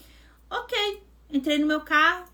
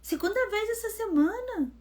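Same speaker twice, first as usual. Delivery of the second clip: alarmed; 7 semitones higher, 3 dB quieter than usual. Retold or imitated. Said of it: "E eu apavorada".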